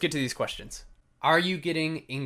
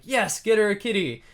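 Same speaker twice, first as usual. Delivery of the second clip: higher, louder mock cheer; eager commenters; 9 semitones higher, 6 dB louder than usual. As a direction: imitate fans urging him on loudly. Quotes excited commenters urging him.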